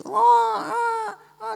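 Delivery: tiruan tangis bayi yang melengking keras; tangisan keras bayi. Acted. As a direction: meraung tinggi seperti bayi. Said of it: "anak itu keras dari bunyi". (menirukan tangis bayi yang keras)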